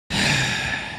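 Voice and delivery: long unvoiced exasperated sigh; tired sigh of frustration; weary sigh. Real, not acted. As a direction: let the breath out, fed up. Frustrated with chat.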